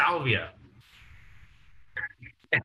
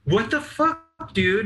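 high, slow startled "what the"; mock startled friend; 10 semitones higher, slower than usual. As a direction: drag it out high, caught off guard. Zach startled, caught.

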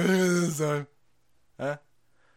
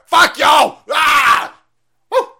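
loud high shout; loud gleeful shout; defiant yell; 10 semitones higher, 13 dB louder, much slower than usual. Real, not acted.

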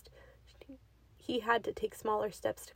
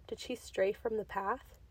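plain reading voice

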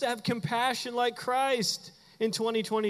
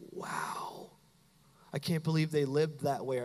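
very soft, breathed wow; hushed amazement; 4 semitones lower, 5 dB quieter than usual. Acted. Preacher voicing compassion for somebody's hardship, hushed. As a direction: let out a near-whispered wow. Acts being moved by compassion.

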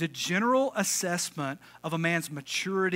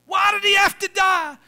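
high, loud shouted lament; mock yelling; 12 dB louder than usual. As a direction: wail it across the graveyard. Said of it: "he kept yelling".

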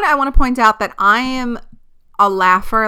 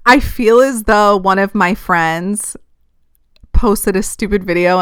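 ordinary talk, nothing marked

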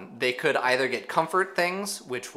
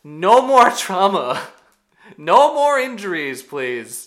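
loud, high, exaggerated begging; drawn-out, high-pitched protest; exasperated plea; 7 semitones higher, 11 dB louder, slower than usual. Real, not acted. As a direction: cry out for it to stop. Pleading after gruesome injury talk.

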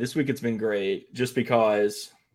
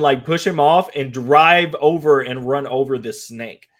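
ordinary talk, nothing marked

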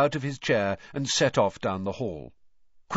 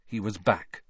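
plain reading voice

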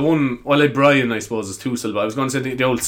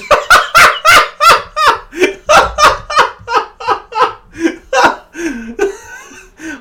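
helpless laughing fit; 8 dB louder than usual. Real, not acted. Cracks up at own flub.

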